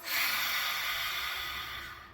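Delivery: long audible breath out through the mouth. Shown as a demonstration. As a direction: let the air go out of the mouth with a sigh. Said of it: "out through your mouth".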